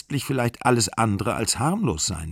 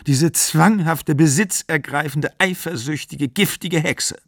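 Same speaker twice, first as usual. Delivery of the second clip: high-pitched, indignant name-calling; acted exasperated rant, higher voice; exasperated outcry; 6 semitones higher than usual. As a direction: spit out each insult in rising outrage. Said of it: "rief sie".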